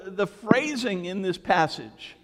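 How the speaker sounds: ordinary talk, nothing marked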